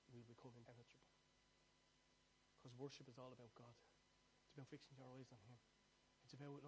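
soft, intimate sermon voice; hushed reflective preaching; quiet preaching. Acted. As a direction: draw them in close and low.

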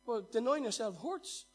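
fiery pulpit delivery; full-force preaching. Acted. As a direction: preach it hard to the crowd.

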